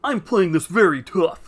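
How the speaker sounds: loud, exaggerated tough-character voice; tough henchwoman. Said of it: "like, a very deep voice".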